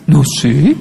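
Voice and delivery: put-on low, giggly teen shrug; acted caught-out kid, laughing; sheepish laughing reply. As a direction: answer it guiltily, then break into a chuckle. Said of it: "riéndose más dice".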